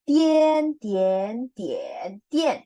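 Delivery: Dian through the four tones, first loud and high; Dian: high, rising, dipping, falling; four Mandarin tones. Shown as a demonstration. Teacher drilling tones on Dian. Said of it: "agak T I E N ya".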